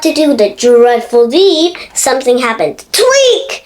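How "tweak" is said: sharp high tweak sound; high squeak. A narrator's reading.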